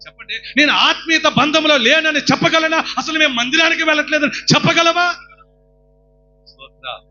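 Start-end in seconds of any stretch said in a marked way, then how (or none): 0.1-5.1 loud, high preaching cry; fervent sermon shout; full-force preaching. Acted. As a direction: exhort the crowd with all your voice.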